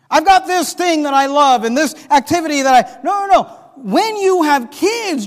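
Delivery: high, loud, insistent sermon; building pulpit rebuttal, pitch climbing; fired-up preaching. Acted. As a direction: knock down each excuse forcefully. Preaches with full force.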